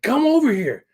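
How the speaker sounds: high, loud retold yell; urgent calling out. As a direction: act out calling someone over. Retold calling son over to look.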